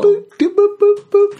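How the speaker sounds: string of very high blips; high-pitched booping. Acted. Mind counting off many crushes, high booping. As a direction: keep the tiny boops popping. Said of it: "in my mind's like".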